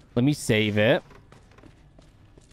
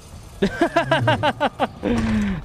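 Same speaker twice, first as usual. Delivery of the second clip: laugh; 11 semitones higher than usual. Real, not acted. Laughs at the monster.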